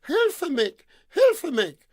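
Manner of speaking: high, loud cry of a damsel; maiden's shout for help. Acted. A maiden calls from her tower, crying out for help. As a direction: shriek for help from far away. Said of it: "she was shouting".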